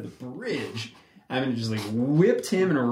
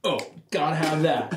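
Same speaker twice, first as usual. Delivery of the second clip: eager producer; 6 semitones higher, 3 dB louder than usual. Retold or imitated. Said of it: "everybody would be like".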